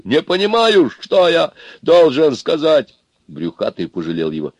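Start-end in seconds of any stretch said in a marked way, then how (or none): 0.1-3.0 high, loud, tearful cry. A narrator's reading. Аристарх beats his chest helplessly, tearful. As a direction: wail it with tears in the voice. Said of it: "в голосе его послышались слёзы".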